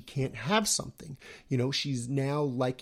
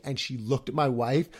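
ordinary talk, nothing marked

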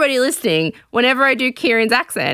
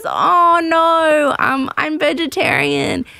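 higher, hesitant polite letdown; mock-apologetic, pitched up; acted awkward refusal; 6 semitones higher, slower than usual. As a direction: imitate someone apologetically declining. Retold awkward refusal of pie.

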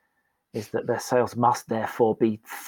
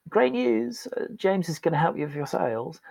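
bright, much higher, chirpy corporate cheer; falsely cheerful announcement; 7 semitones higher than usual. Retold or imitated. To the chosen staff, a sugary upbeat announcement. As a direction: sell bad news as great news. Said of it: "what they heard was".

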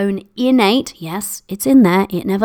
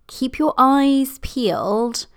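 ordinary talk, nothing marked

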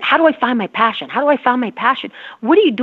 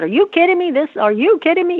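mock upset disbelief, higher; retold exasperation; 7 semitones higher than usual. Recalling the anger stage, mock exasperated. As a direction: replay the outrage of finding out. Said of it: "I got very upset".

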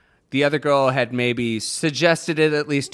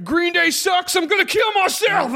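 put-on whiny emo teen. Mocking American student.

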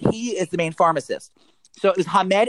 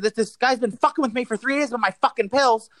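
higher, heated complaint; cursing like a maniac, retold; 8 semitones higher than usual. Irate customer complaining to security lady, retold rant. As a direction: re-enact ranting without full volume. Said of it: "cursing, like, like a maniac".

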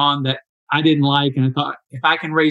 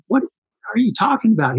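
incredulous; 7 semitones higher than usual. Retold or imitated. Retelling his disbelieving reply to Chris.

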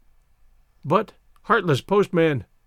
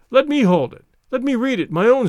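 excited; 6 semitones higher, 4 dB louder than usual. Acted. Bobby eager grab.

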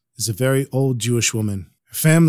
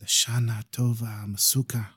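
quiet wish; 2 semitones lower, 9 dB quieter than usual. Acted. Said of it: "He wishes her quietly in a whisper".

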